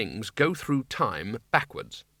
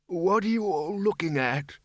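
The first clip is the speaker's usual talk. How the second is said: put-on old man; 6 semitones higher than usual. A narrator's reading. Old Windle voiced, higher, far.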